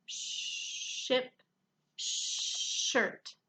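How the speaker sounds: "Ship, shirt" with each sh drawn out, quietly; comparing sh sounds. Shown as a demonstration. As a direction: stretch the sh in both words. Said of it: "Does it make the same sound".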